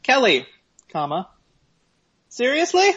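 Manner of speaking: seriously? rising high in disbelief; exasperated email quote. Retold or imitated. Quoting his reply to the weed dealer, exasperated. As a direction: read your own fed-up email aloud. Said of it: "I said".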